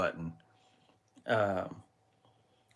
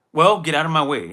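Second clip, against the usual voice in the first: much louder mock of the man, "Well" thrown out hard; retold rude demand; 4 semitones higher, 9 dB louder than usual. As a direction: imitate the rude shopper barking at you. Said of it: "he's like".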